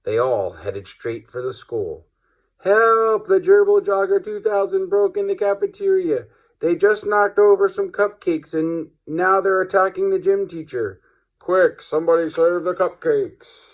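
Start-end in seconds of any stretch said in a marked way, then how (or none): 2.6-13.7 higher, frantic cry for help; panicked cry for help. Acted. A child cries for help in the cafeteria, panicked. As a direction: yell like a kid in a panic. Kids panic at gerbil attack.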